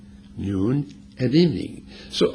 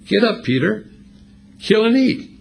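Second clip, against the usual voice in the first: higher, louder divine command ringing down; voice from heaven; 10 semitones higher, 4 dB louder than usual. Acted. Heavenly voice commands Peter to kill and eat, raised and higher. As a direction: call it down from above like God commanding. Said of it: "A voice came to him".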